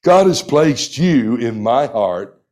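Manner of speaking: ordinary talk, nothing marked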